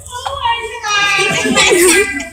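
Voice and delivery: high, loud, drawn-out fan squeal; starstruck gushing. Retold or imitated. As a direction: gush it slowly through laughter, imitating yourself starstruck. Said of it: "I met Tara Strong once and I was like".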